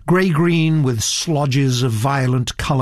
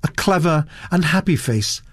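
usual speaking voice

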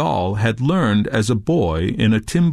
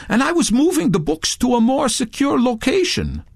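much higher, insistent protest; 13 semitones higher than usual. A narrator's reading. Touré disputing Redbeard's charge, insistent. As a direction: insist on ownership, pitch well up. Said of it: "Touré insisted".

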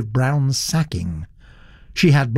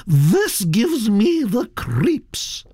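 put-on high, gossipy old aristocrat voice, pitched well up; elderly Duchess; 10 semitones higher, much slower than usual. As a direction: chatter on like a grand old lady.

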